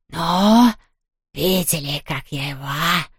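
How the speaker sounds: soft echoing boastful ghost; proud ghost. A narrator's reading. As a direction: brag like a little ghost. (гордый голос привидения)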